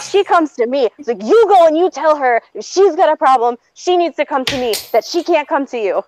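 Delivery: high, indignant ultimatum; heated retold demand; retold screaming rant. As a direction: lay down the law angrily.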